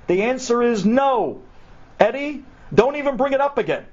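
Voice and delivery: high-pitched, emphatic, final no; upset refusal. Acted. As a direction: shut down your friend in indignation. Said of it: "he gets so upset".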